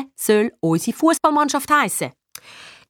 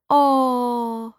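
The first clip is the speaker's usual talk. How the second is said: long sweet "oh"; fluted coo. A narrator's reading. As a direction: coo it like a gushing sister. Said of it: "flötet Elian". (flötend)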